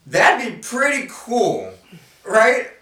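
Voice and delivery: delighted, rising question; excited. Real, not acted. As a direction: gush over how cool it would be. Excited about meeting an angel.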